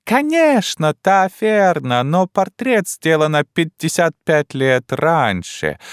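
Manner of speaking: put-on high, slow, broken-Russian old Frenchwoman; a bewildered elderly French wife, high and slow; old Frenchwoman puzzled by her husband; old French lady. Old French lady's lines.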